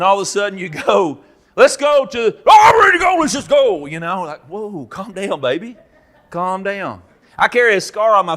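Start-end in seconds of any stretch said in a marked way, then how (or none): 1.5-3.8 very high, loud, eager shout; fired-up shout. Acted. The fired-up new guy in the Life Changers shirt, excited shouting. 4.5-7.0 softer, soothing settle-down; calming down. Acted. Calming the overexcited new guy, soft and soothing.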